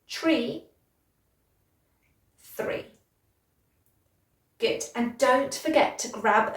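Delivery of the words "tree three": T in tree, tongue-between-teeth TH in three; tree three contrast. Shown as a demonstration. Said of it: "one more time".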